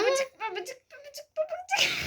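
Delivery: very high squawky parrot voice. Shown as a demonstration. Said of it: "Papağan sesiyle babacık de".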